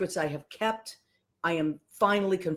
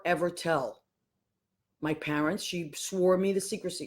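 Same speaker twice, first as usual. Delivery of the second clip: usual speaking voice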